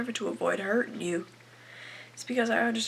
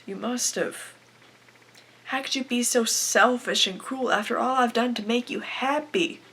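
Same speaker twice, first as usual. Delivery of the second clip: building angry reproach, stressed hard; hurt, angry accusation; 3 dB louder than usual. Acted. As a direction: accuse him, voice rising with hurt. Acted accusation of absent husband.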